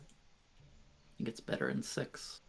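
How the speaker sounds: low, swallowed words under the breath; mumbling. Real, not acted. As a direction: mumble it to yourself. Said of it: "I'm mumbling".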